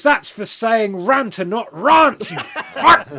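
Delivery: high, loud shout cracking into laughter; laughing mock yell. [name] slapping Higton, shouting through laughter. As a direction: yell the insult while trying not to laugh.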